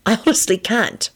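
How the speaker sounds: mildly desperate. A narrator's reading. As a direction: plead that you can't. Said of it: "he finished desperately".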